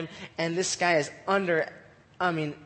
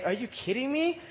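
higher, softer disbelief; mock-incredulous rising question; incredulous Goliath; 4 semitones higher, 5 dB quieter than usual. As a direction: ask it softly, pitched up in disbelief. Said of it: "And Goliath's like".

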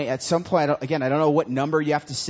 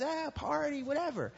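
mock party kid; 11 semitones higher, 8 dB quieter than usual. Mocking partying friends.

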